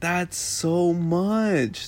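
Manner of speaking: high, drawn-out wonder; slow, high-pitched marvelling; retold amazement. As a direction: stretch it out, overwhelmed. Retells being overwhelmed by the number.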